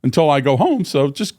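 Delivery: high, quick, eager offer; eager to please. Retold or imitated. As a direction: rattle off I'll do anything. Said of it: "I would always tell her".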